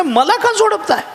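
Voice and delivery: high, laughing protest of the wife; wife's shocked protest. Acted. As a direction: squeal it out, half laughing. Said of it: "ती म्हणे". (उंच आवाजात हसत हसत बायकोचा धक्का)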